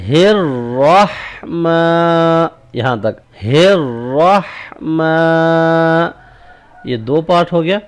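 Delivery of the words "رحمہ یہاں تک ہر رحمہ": ہر رحمہ chanted slowly, drawn out; tajweed section demo; part two drill. Shown as a demonstration. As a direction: recite the second part slowly. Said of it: "number دو ہا سے".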